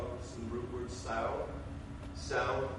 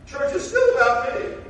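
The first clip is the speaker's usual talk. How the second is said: mocking self-centered voice; 10 semitones higher, 11 dB louder than usual.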